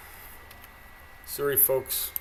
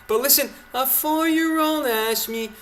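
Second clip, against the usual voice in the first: mocking imitation, pitched up; Chrystia Freeland; 7 semitones higher than usual. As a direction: mimic an over-coached politician in a higher voice. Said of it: "speech lessons 'cause she's a fucking idiot".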